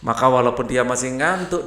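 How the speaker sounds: ordinary delivery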